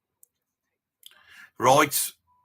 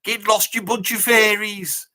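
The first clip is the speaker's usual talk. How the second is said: higher, drawn-out mock taunt; retold irate taunt; 7 semitones higher than usual. Geezer taunting the mods in an anecdote, mock irate. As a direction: hurl a mock insult, stretching the last word. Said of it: "he's there and he's going".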